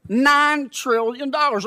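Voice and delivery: NINE pushed high and slow; incredulous repeat. Real, not acted. Senator hammering the Fed chair on money, stressed slow and high. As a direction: lean hard on "Nine trillion dollars".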